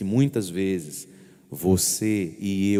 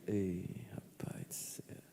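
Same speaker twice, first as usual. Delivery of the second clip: slow whispered regret, hand on chin; remorseful whisper; 15 dB quieter, slower than usual. Shown as a demonstration. Priest showing the faithful how remorse sounds, whispered. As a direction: breathe it out, sorry and hushed. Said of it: "pega aqui assim no queixo".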